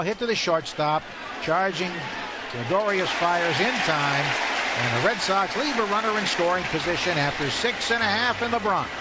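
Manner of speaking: ordinary delivery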